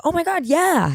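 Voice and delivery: high, gushing yes; imitated delight. Celebrity recognising a writer's name, imitated delight. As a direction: mimic her eager recognition far up in pitch.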